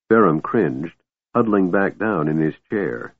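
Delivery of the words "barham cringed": usual speaking voice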